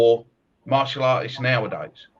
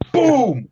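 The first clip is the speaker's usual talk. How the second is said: loud, high mock "boom" of a punch landing; punch sound effect; 10 semitones higher, 6 dB louder than usual. Recalling a sparring partner's punch, loud mock boom. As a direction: smack the word out like a punch landing.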